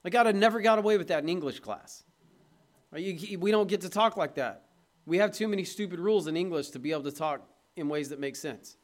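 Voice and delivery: usual speaking voice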